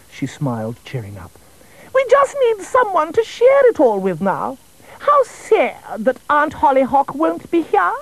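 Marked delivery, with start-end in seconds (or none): 1.9-7.9 much higher, put-upon royal fuss; a busy queen brushing the king off; fussy queen voice. Acted. Queen calling from the kitchen to the king, high and fussy. Queen wishes Aunt Hollyhock came.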